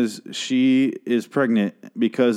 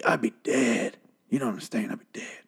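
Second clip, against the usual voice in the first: South Park character; 6 dB quieter than usual. Retold or imitated. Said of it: "why he's a South Park character".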